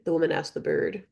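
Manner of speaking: usual speaking voice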